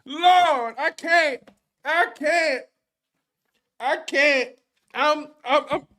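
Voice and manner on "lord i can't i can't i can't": whooping laugh, high; real giddy laughter. Real, not acted. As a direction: laugh it out, overjoyed. Opening in laughter.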